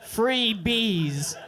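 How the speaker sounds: ordinary talk, nothing marked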